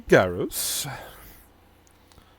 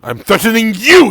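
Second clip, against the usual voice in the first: high, loud menacing retort; threat; 12 semitones higher, 9 dB louder than usual. Acted. Tiefling retorting with a threat to Garros. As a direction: hurl it back. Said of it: "he says".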